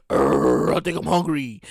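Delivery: low growl then a hungry murmur; stomach growling at its owner, low and rumbling. Pretend. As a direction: groan like an empty belly. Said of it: "your stomach gonna be like".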